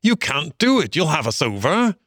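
high, urgent warning without real shouting; alarmed cry. A narrator's reading. Rat to Mole grabbing the sculls, high alarmed protest. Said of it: "cried the rat from the bottom of the boat".

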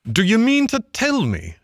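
very high, indignant rat shout. A narrator's reading. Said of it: "shouted the rat".

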